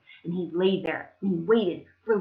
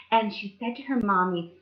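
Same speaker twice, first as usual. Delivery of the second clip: ordinary delivery, nothing marked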